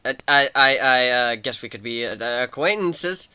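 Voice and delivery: a flustered, stuttering Warren; stammering. Acted. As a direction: stumble over the words in fear. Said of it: "Warren stammered".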